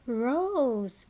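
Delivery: "rose" drawn out slowly; phonics-teacher "rose" with a stretched vowel; long O in rose. Shown as a demonstration. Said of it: "words that have the long sound".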